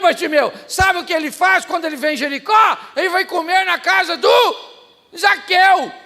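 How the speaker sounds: loud, high preacher's shout; preaching at full force. Acted. Pastor shouting the Jericho story to the church. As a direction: thunder it from the pulpit.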